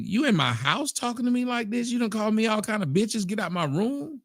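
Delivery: lightly voiced quarrel; mock mama-daughter argument. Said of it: "it's mama and daughter arguing".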